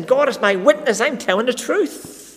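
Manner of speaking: mock oath. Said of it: "It's like saying".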